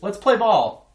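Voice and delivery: loud, high call to play; eager call. A narrator's reading. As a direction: shout it out to get the game going. JT shouts to start game.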